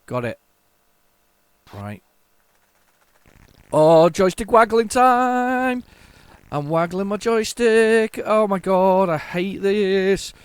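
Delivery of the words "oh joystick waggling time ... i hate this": loud, high, drawn-out holler; slow, effortful yell through hard waggling; straining game shout. Real, not acted. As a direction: stretch the words out while straining. Frantic joystick waggling in game.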